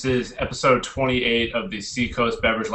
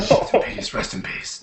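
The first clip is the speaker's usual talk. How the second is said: hushed mock eulogy; mock solemn whisper; 13 semitones higher, 3 dB quieter than usual. Mock mourning the beer glass.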